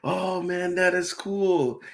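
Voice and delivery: drawn-out starstruck delight; lightly voiced celebrity gushing; Hayden's excited reaction. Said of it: "he was like".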